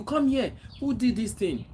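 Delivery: mock stern parent call. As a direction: call the child in sharply, high. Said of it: "parent calling child, quoted".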